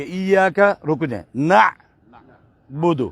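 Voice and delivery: ایاک then a clear stop before نعبد said slowly; pause before naabud. Shown as a demonstration. Teacher demonstrating the pause in the verse. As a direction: break after ایاک, then draw out نعبد. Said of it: "special حرف پہ رکیں".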